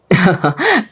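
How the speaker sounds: short acted chuckle; laughing. Oday amused by Nada's offer, chuckling. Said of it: "ضحك عدي وعبد المجيد".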